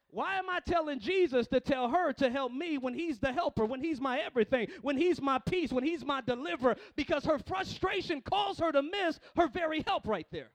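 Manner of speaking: loud, driving sermon delivery; full-force preaching. Real, not acted. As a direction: build repeated phrases like a fired-up preacher.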